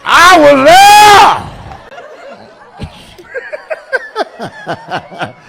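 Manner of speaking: put-on high, loud old-timer boast; old man catchphrase. Old man bragging to the crowd, high and loud. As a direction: shout it like a proud old geezer.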